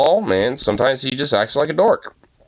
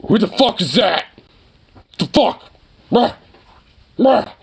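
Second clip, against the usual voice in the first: lightly voiced dog, pitched up; indignant dog's voice; 7 semitones higher than usual. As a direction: voice a startled dog demanding answers. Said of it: "Zukov is just like".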